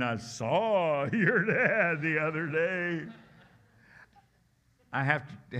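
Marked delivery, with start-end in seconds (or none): 0.0-3.1 slow, higher teasing schoolkid voice with a laugh; mocking kid, laughing. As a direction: drag it out like a giggling schoolkid teasing. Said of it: "I mean".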